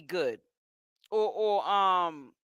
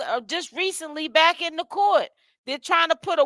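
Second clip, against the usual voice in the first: normal talking voice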